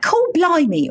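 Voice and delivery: high mock-Cockney exclamation; hammed-up American take on Cockney British; Cockney British accent. Showing how Americans do British, mock Cockney. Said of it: "it's either like".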